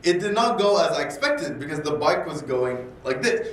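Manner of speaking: usual speaking voice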